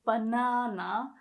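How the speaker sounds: Banana slow, first a open like Japanese a; careful slow Banana; demo word. Shown as a demonstration. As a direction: stretch out Banana to show the a. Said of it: "the first A is pronounced more like".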